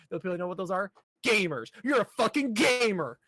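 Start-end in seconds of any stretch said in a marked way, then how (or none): none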